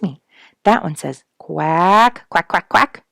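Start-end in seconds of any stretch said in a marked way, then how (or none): 1.4-3.0 loud rising quacks like a mallard; duck quacking. A narrator's reading. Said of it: "said Missus Mallard".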